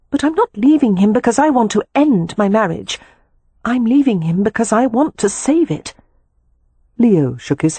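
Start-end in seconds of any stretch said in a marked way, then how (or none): 0.1-5.9 high-pitched, determined woman's voice; earnest sister. A narrator's reading. Wife explains to brother why she's leaving, earnest.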